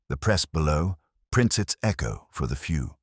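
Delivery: usual speaking voice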